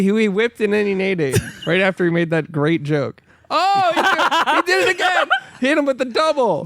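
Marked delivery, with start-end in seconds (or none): 3.5-6.6 very high laughing squeal. Real, not acted. Excited reaction to joke.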